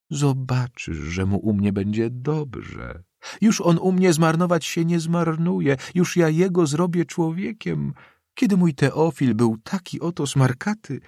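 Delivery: high-pitched, fervent promise; granny insisting with feeling; emotional old woman. A narrator's reading. Old woman promises to care for boy.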